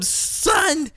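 a dad at the end of his rope sending his son away; retold frustrated outburst. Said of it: "And I said to him".

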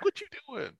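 very high-pitched incredulous reaction; high, comic quoted question; mock disbelief. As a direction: squeak it out in mock bafflement. Acted reaction to background dancers.

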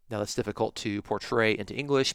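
ordinary talk

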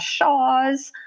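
SHAWS, the L dropped from shawls; shawls without L. Real, not acted. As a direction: say "shaws" with no L sound. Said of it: "I know, I say shaw".

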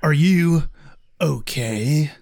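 halting, hammed-up concerned hero; mock-heroic concern; dramatic reading. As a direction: pause heavily before the last word. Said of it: "we need a mini dramatic reading here".

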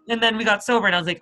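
usual speaking voice

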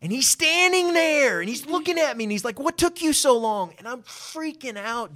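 high, loud, stretched-out astonishment; freaking out. Retold or imitated. Acting out shock at the meeting.

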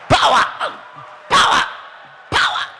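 loud punched cries; mock altar shout. Preacher mocking altar-call shouts, loud.

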